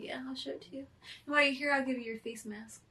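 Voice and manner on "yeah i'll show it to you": whispering. Real, not acted. Quick aside to cohost.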